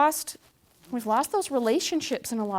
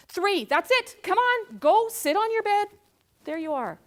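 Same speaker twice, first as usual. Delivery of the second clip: exasperated parent countdown; 5 dB louder, slower than usual. Retold or imitated. Parent counting down at toddler, stern and loud.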